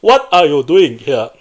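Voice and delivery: slow, loud mock African accent. Shown as a demonstration. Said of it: "So for African, it's gotta be".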